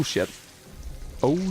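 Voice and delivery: ordinary delivery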